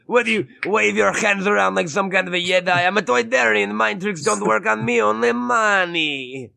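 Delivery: hammed-up Watto voice; Watto impression. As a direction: growl it like a greedy alien dealer. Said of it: "you mean this?".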